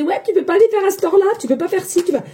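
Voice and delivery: mock bossy follower, sped up; mocking demands. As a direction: rattle off the demands like a pushy viewer. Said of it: "entre me demander".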